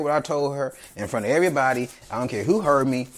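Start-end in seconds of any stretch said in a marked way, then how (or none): none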